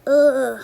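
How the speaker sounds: pained ugh like a sore belly; stomachache groan. Shown as a demonstration. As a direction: groan as if your tummy hurts. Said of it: "Like the boy with his stomach hurting".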